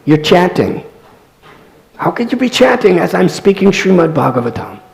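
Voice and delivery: loud, high-pitched indignant scolding; chastising. Retold or imitated. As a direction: act out a teacher rebuking a distracted listener. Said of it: "and chastised her".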